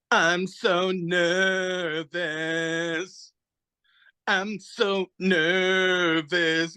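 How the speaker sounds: drawn-out, slow lyric reading; whiny singer. Retold or imitated. Lead singer reading diary lyrics, slow and limp. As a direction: stretch it like a bad lyric. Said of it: "And she's like".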